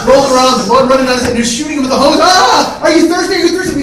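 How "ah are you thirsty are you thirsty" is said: high, loud hammed-up concern; frantic mock concern. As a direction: ask it while panicking. Kids spraying hose at victim.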